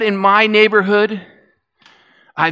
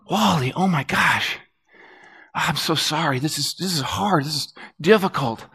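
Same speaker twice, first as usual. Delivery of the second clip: softer, hushed sympathy; tender comfort; 3 semitones lower, 7 dB quieter than usual. Retold or imitated. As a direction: gently console a grieving friend. Comforting grieving neighbor.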